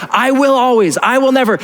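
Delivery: high-pitched emphatic preaching; emphatic vow. Acted. Preacher warns church about rigid promises, emphatic. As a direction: hammer each phrase, pitch held high. Voicing a stubborn, locked-in vow.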